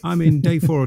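lockdown-giddy greeting to a mate; overexcited. Real, not acted. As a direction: burst out, starved of company. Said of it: "if I get a bit overexcited".

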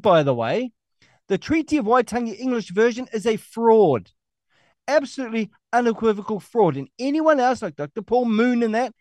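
high-pitched, fired-up tirade; worked-up political harangue; heated rant. Real, not acted. Heated rant against opponents.

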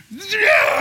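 high, loud screaming cheer; retold shriek of excitement; everybody screams. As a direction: scream it like a wild crowd. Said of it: "everybody screams".

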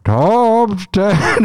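high, loud announcer imitation. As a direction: belt it like the segment jingle. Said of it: "the voice of that one".

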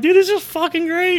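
high, loud, thrilled; elated shout. Pretend. Host thrilled with his tripping guests, high excited yell. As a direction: whoop like the best episode ever. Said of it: "he's like".

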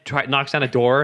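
ordinary talk

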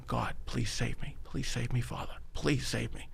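hushed, pleading prayer under the breath; quiet prayer. Retold or imitated. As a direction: beg God softly, almost to yourself. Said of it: "I start praying".

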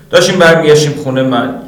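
plain reading voice

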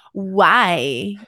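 mock snotty "Why?"; imitating the daughter's snotty tone. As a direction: toss off a snooty little why. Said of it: "Like that".

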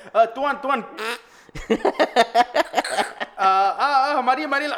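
stifled giggles. Pretend. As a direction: pretend cop barely holding in laughter. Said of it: "He's just trying not to laugh".

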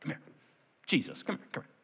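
hushed, hurried aside to Jesus; discreetly pulling aside. Acted. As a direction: beckon him away quietly, as Peter. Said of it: "Peter took him aside".

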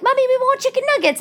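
put-on high, fast little girl asking; little daughter's voice. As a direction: chirp it like an eager small kid. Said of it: "my daughter's like".

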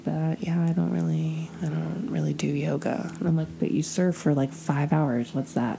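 quiet mumble. Retold or imitated. Imitating the guy, mumbled.

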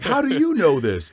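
squeaky high astonished "how do you know this"; high, loud, disbelieving; amazed question. Retold or imitated. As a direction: ask it stunned, voice jumping way up. Shocked retold reaction.

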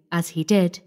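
plain reading voice

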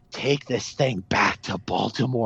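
low, slow imitation of a mortified senator. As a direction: drop the pitch and slow down, as if appalled. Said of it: "she's just mortified".

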